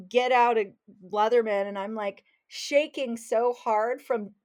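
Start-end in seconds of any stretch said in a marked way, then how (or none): none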